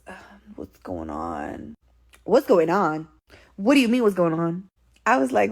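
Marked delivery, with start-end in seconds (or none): 0.1-1.7 low, slow, soft groggy mumble; sleepy voice. Retold or imitated. Boyfriend feigning sleep on the phone, groggy voice. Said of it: "In the sleepy voice". 2.2-4.7 sharp, incredulous comeback; indignant echo. Retold or imitated. Answering boyfriend's fake sleepy act, indignant. She indignantly echoes his question.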